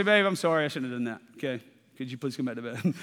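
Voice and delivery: soft apology. Retold or imitated. Husband apologizing to wife at night.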